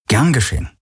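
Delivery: breezy put-on nonchalance; feigned casual. A narrator's reading. Garland brushing off Kirai's thanks, feigned casual. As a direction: toss it off like it's nothing. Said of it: "gespielt locker".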